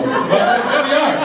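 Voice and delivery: laughing through the words; amused laugh breaking in. Real, not acted. Laughing about ski trip swearing.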